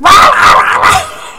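very high, loud babbling scream; mock kid shriek. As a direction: imitate a child going berserk. Imitates Adonis screaming.

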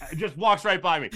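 ordinary delivery

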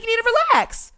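high-pitched exasperated retort; retold outburst, voice shooting way up; yelling. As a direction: order them to relax while worked up. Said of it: "Now I'm yelling at you".

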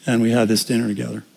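usual speaking voice

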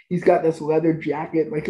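ordinary delivery

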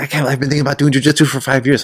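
eager newbie. Retold or imitated. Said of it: "he's like".

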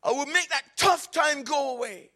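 high, loud preacher's cry; full-force preaching. Acted. Preacher to congregation about hard times, full-force preaching.

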